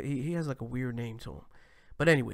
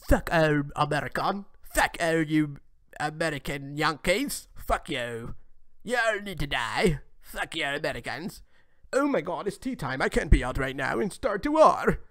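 louder, hammed-up English bloke; mock British accent; 4 dB louder than usual. Mocking the UK rapper to viewers, hammed-up British accent. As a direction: overdo a posh tea-time Brit. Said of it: "gonna come out here like".

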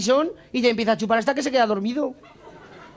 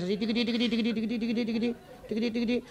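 quiet tiqui tiqui patter; hushed playful tapping noise; soft tiqui sounds; 10 dB quieter than usual. Acted. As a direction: murmur the tiquis softly like light tapping. Sergeant feeling the recruit's testicles.

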